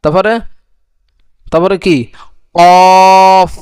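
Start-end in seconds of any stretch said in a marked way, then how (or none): 2.6-3.5 কফ loud and heavy from the tongue's root; heavy letter. Shown as a demonstration. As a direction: make কফ thick and forceful. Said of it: "এ কফটা একটু মোটা হবে".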